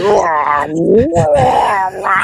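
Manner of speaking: mock wail of a gurgling stomach; stomach gurgling noise. Teller imitates his passenger's gurgling stomach with wahs. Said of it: "It was like".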